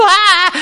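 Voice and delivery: loud, high mock scream of alarm; hammed-up cry of panic; panicked yelp. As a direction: yelp like a parent seeing a toddler near an edge. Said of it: "Und Panik".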